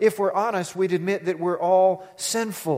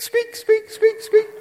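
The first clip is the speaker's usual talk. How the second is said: high, louder squeaky car noise; mimicked brake squeak, getting louder; squeak imitation; 5 dB louder, slower than usual. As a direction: squeak like a worn brake, rising in volume.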